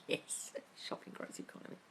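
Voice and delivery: sneaky whisper. Real, not acted. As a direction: whisper it like a guilty secret. Whispered after rampage over.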